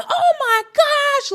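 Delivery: very high, slow, breathless gasp of awe; describing the rush of hanging mid-air, high and awed; thrilled rush. Retold or imitated. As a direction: let the thrill lift the voice way up. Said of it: "You're like".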